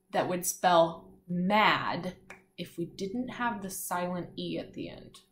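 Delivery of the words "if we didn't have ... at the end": soft whispered aside; hushed clue to the class; whispered hint. Real, not acted. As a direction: slip the hint in under your breath.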